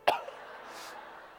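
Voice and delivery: sickly father's acted coughing; put-on cough. Ailing father on the phone, put-on coughing. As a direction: cough pitifully for sympathy. Father playing up his illness.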